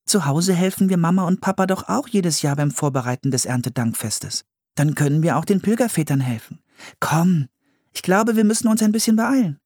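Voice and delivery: put-on high, lively girl's voice; encouraging Anne. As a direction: urge your brother along as Anne. Voices Anne urging her brother.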